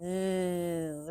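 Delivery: long buzzing zzz; teacher modeling letter sound. Shown as a demonstration. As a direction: hold the buzz of the zzz. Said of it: "What letter says zzz?".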